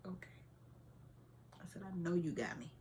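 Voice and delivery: very soft, near-whispered surrender; subdued acceptance. Retold or imitated. Mother answering God after her outburst, hushed acceptance. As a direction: give in quietly, barely voiced.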